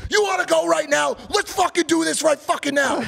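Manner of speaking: high, loud belligerent shouting; retold fight challenge. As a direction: imitate a raging man demanding a fight.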